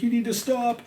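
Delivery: imitated plea, pitched very high; tearful pleading. Crying friend begs him out front, tearful pleading. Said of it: "she was in tears, like, pleading with me".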